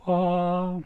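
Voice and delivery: sudden high delighted wah; surprised yelp. Real, not acted. Host cries out as the logo pops up, high surprised yelp. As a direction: jump at the screen popping up.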